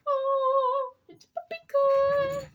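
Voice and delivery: very high sing-song puppy talk. Real, not acted.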